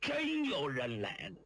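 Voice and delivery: usual speaking voice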